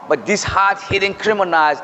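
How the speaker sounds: ordinary talk, nothing marked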